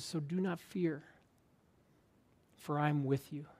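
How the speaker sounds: soft, deliberate verse with long pauses; unhurried, hushed scripture recitation; slowed down. Retold or imitated. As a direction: let each phrase sink in quietly. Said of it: "I had to slow down".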